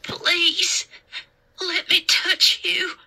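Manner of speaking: put-on high, tearful little-boy voice; plaintive, high and drawn out; pleading scared child. A narrator's reading. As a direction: beg for comfort in the dark. Scared boy begs to touch her.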